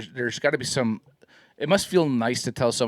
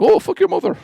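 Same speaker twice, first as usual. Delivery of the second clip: high, louder mock insult; mock scammer insult; 7 dB louder than usual. Imitating the scam caller cursing him, high and louder. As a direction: squeal it like the rude caller. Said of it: "I used to get some things like".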